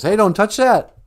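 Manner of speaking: high, loud, sudden yell; urgent warning shout. Acted. Bruce Wayne stops the boy touching the clock, with an urgent shout. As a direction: shout to stop someone touching it.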